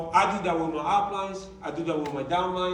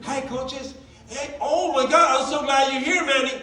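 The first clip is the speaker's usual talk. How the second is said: high, loud overjoyed hello; gushing greeting; 7 semitones higher, 5 dB louder than usual. Retold or imitated. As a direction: greet them like long-lost friends. Brother gushes arriving at meeting.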